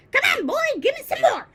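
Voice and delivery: high, loud demanding crone; greedy yell. A narrator's reading. Grandma demanding a dose from George, yelling. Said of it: "she yelled".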